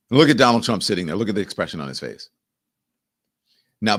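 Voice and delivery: ordinary delivery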